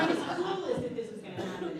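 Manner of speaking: laughing. Real, not acted. Laughing through proposal memory.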